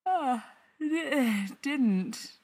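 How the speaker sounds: words stretched through a yawn. A narrator's reading. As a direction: talk through a sleepy yawn. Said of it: "Patrick yawns".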